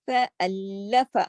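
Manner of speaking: فَأَلَّفَ with the doubled لّ held; shadda on lam. Shown as a demonstration. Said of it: "لام پہ دو لام ہے". (فَأَلَّفَ میں لام پر تشدید)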